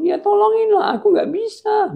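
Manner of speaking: very high, loud whine; hammed-up squealing plea; desperate begging. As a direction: beg helplessly at the top of your range. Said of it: "Tapi dia bilang".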